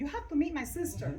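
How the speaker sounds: eager, pushy quote, pitch swinging; mock insistence. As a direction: imitate a friend pressing an introduction. Acts out friend insisting.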